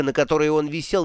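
usual speaking voice

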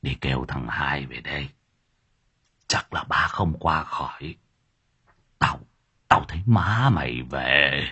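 dying grandfather whispering his last wish; feeble whisper. Acted. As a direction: barely get the words out. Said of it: "miệng thều thào".

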